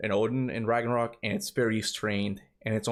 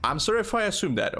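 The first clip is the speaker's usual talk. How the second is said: high, lively curious voice; eager, high questions; 12 semitones higher than usual. Real, not acted. Streamer eagerly asking a viewer if he's from Miami. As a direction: ask eagerly then apologise.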